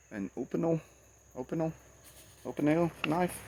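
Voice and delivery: "Opinel" repeated as OH-puh-nel, feeling out the word; unsure pronunciation. Shown as a demonstration. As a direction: test out "Opinel" as OH-puh-nel, checking each try. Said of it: "Hope I'm saying it right".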